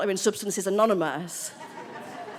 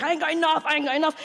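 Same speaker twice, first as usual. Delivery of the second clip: higher-pitched, fretful repetition; rising panic; 6 semitones higher, faster than usual. Acted. Addict looking at a dwindling stash, panicking. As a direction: fret it over and over. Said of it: "the rising panic".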